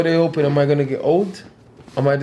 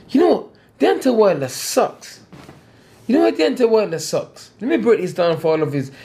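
heated rant; 6 semitones higher than usual. Real, not acted. Ranting angrily about rival Wilder.

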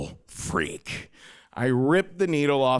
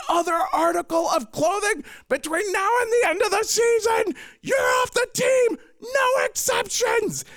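screaming fit; 12 semitones higher than usual. Retold or imitated. Coach Mister Becker ranting at his team, screaming fit. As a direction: tear into the boys about the dress code. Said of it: "just launched into a screaming fit".

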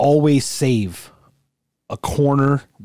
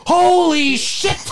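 high drawn-out "holy shit"; shocked yell; much slower than usual. Retold or imitated. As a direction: stretch it out, stunned. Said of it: "the Animal House horse".

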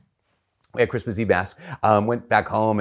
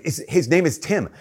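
high, eager announcement; acted-out boyish eagerness; proud excited kid; 7 semitones higher than usual. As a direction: announce the pet's name like a proud child. Child proudly names his dog.

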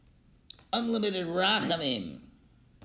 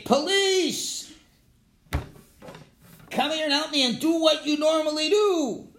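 high, raised call to the police; acted appeal shouted to an officer; calling out for help; 8 semitones higher, 3 dB louder than usual. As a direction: call them over, trusting they will come. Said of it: "calling out".

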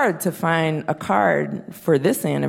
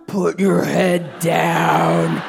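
stern slow command; much slower than usual. Retold or imitated. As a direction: drag out a stern mom order. Child snapping at doll.

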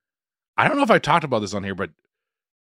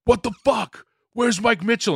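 mock yelling AD; 11 semitones higher than usual. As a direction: imitate the boss yelling across set, in a high voice. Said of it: "he started yelling that on set".

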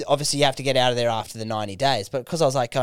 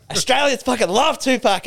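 much higher, eager burst of enthusiasm; retold gushing answer, pitched way up; excited; 11 semitones higher, 3 dB louder than usual. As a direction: blurt it out like a hyped fan.

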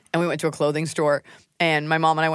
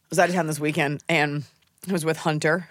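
normal talking voice

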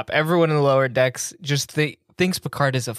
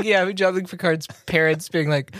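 ordinary talk, nothing marked

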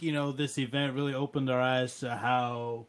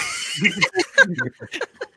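loud high laugh; 7 dB louder than usual. Real, not acted. Laughs at finding the cat.

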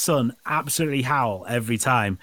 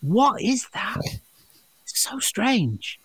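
higher, slow bewildered voice; a hint of a baffled pub customer; lightly voiced puzzled man; 8 semitones higher, 3 dB quieter, slower than usual.

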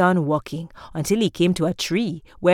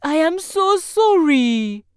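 apologetic man; 9 semitones higher than usual. A narrator's reading. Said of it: "said the man".

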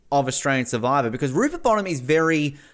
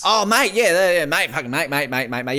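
blokey Aussie "mate, mate" impression, louder at the start; Guy Leach impression; 4 semitones higher, 4 dB louder than usual. Co-host asks for the Guy Leach impression, blokey mate voice. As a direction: chant "mate" like a laid-back Aussie bloke. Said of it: "your Guy Leach impression".

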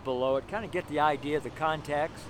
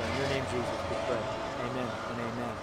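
a quiet, softer prayer of thanks to Jesus; spoken prayer; 4 semitones lower, 4 dB quieter, faster than usual. Real, not acted. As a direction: pray it softly, head bowed. Prays aloud after the sermon.

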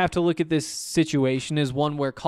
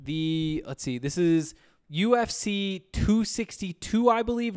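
ordinary talk, nothing marked

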